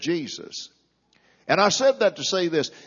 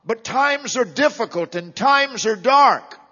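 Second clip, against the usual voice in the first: loud, raised-pitch impassioned complaint; protesting believer; 6 semitones higher, 5 dB louder than usual. Acted. Preacher voices faithful churchgoer questioning God, loud and insistent. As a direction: press each claim forcefully, like pleading a case. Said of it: "Many could have asked the question".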